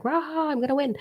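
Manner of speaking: high, squeaky mock battle cry; cartoonish high, soft victory growl; mock competitor. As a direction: lampoon a pumped-up racer. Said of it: "a human who's like".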